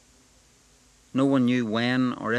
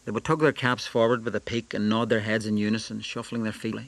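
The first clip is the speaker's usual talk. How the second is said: ordinary delivery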